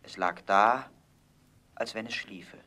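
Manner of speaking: usual speaking voice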